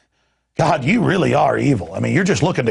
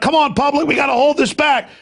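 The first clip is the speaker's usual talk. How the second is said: rallying shout; 11 semitones higher than usual. Retold or imitated. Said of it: "I'm like".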